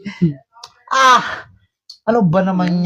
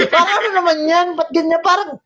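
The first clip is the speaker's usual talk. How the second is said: laughing; 5 semitones higher than usual. Real, not acted. Laughing while being tickled.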